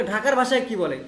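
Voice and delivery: normal talking voice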